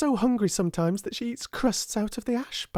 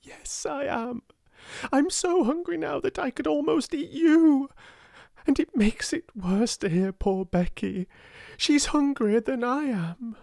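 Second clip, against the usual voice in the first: mild passionate confession. A narrator's reading. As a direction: let the hunger burst out. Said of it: "in a new passionate way".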